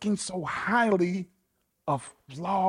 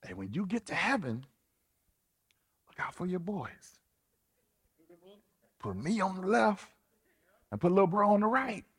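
soft, quick, slangy ask; casual buddies asking a favor; 5 dB quieter, faster than usual. Retold or imitated. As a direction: sweet-talk Jesus like old friends. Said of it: "James and John was like".

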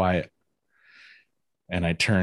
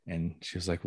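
normal talking voice

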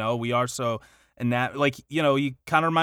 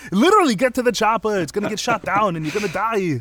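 loud, higher mock Austrian action hero; Arnold Schwarzenegger; 7 semitones higher, 5 dB louder than usual. Podcaster riffs on chopper catchphrase, loud mock Austrian. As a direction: rant in a hammed-up Austrian accent. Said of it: "imagine if Arnold Schwarzenegger was Irish".

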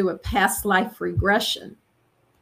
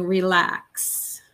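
changed hypnotic voice; 3 semitones lower, much slower than usual. Retold or imitated. Beings on the table calming her, lightly voiced. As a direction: speak as the beings soothing her under hypnosis. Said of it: "My voice would change".

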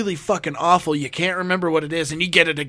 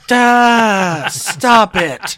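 louder, higher, slow drawn-out stop; loud cut-off; 7 semitones higher, 10 dB louder, much slower than usual. Real, not acted. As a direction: drown out the joke. Cutting off crude joke.

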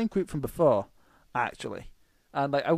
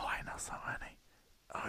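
whispering; 15 dB quieter than usual. Real, not acted. Eager whispered aside.

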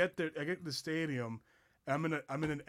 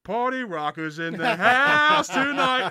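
slow, loud, high announcer call; stadium announcer; 9 semitones higher, 11 dB louder, slower than usual. Retold or imitated. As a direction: boom it over the stadium speakers. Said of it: "all you hear in the background is like".

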